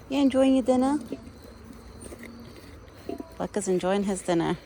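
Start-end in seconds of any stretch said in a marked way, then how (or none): 0.1-1.0 pet talk. Real, not acted. Cooing to the deer.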